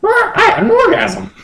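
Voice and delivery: very high falsetto creature; squeaky bird-Pokemon voice; high Moltres voice. Acted. Moltres asking Justin to fly home, squeaky high.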